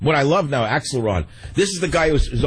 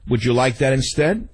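ordinary delivery

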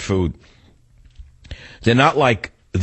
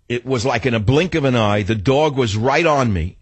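usual speaking voice

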